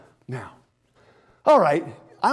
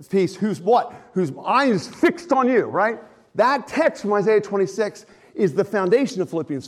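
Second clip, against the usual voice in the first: raised, fervent preaching voice, slowed into the key line; preaching at full force; 6 semitones higher, 6 dB louder than usual. Real, not acted. Pastor to his congregation on Philippians, fervent preaching. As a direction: drive the scripture home to the room.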